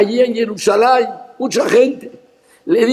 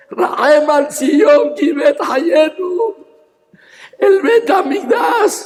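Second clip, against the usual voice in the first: slow proclamation, then a cry much higher; shouted proclamation; 4 semitones higher, slower than usual. Acted. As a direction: cry it out to the whole congregation. Shouted climax of the sermon. (proclamación gritada)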